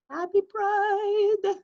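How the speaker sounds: high, drawn-out cheer; festive echo. Real, not acted. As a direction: sing it out, happy and high. Host echoes festively.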